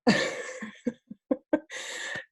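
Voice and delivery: soft, breath-filled giggle; real laugh trailing on quietly. Real, not acted. Coach amused by her own bottle comparison, giggling.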